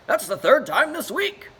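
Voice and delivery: high, loud put-upon complaint; moaning uncle. Acted. Uncle Dennis complains after being stabbed again, high and loud. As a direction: groan it out, fed up. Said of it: "Dennis moaned".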